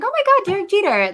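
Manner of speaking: high-pitched starstruck squeal at normal volume; little girl fangirl. Retold or imitated. Little girl starstruck by a ballplayer, high fangirl gush. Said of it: "like, a little girl".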